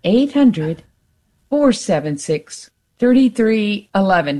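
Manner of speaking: long pauses between the number groups; slow phone number. Real, not acted. Host spelling out the pledge number for drivers, slowly. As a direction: say the number slowly enough to memorize. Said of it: "Did I put you to sleep saying it so slow".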